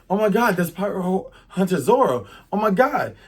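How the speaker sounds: high, loud awed alarm; acted shock. Pirates recognize the feared hunter, high acted shock. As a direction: exclaim it as a startled crowd. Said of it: "And so they're like".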